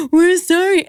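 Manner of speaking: put-on high childish wail; mock tearful apology. Boys apologizing to upset mom, high mock-tearful wail. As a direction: imitate kids about to cry. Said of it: "they start to cry".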